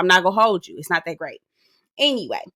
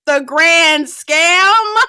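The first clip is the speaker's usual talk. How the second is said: loud, high, very drawn-out disbelief; gleeful hollering; 8 semitones higher, 8 dB louder, much slower than usual. Real, not acted. Relishing Sharice's insult for Karen, gleeful hollering. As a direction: stretch each word and holler it upward.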